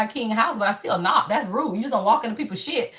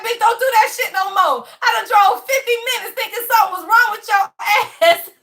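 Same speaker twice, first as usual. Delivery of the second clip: high, shouting mock scold; laugh-yell at him; retold shout at boyfriend after the long drive; 8 semitones higher, 5 dB louder than usual.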